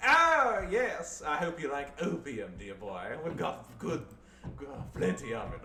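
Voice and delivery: mock a pompous colonial toff; over-the-top upper-class Brit; posh Brit. Continues posh Brit bit.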